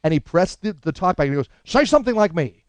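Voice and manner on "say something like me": high, demanding request; pitched-up comic impression; Albert Brooks. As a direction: do his needy order over the talkback. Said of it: "I actually do an impression of him".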